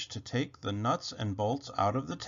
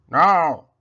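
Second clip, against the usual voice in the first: robot's loud no; 3 semitones higher, 13 dB louder than usual. Acted. Robot answers flatly.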